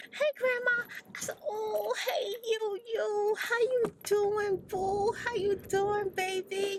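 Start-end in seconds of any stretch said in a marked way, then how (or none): 0.1-0.8 put-on high, sweet little girl greeting; bright childlike hello; granddaughter voice. 1.5-6.8 higher, slow, doting hello; tender granny voice; sweet grandma greeting. Retold or imitated.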